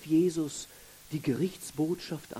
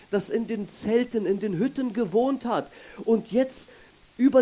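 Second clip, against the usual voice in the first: rising sermon preaching; 5 semitones higher, 4 dB louder than usual. Acted. Preacher builds on the feast day.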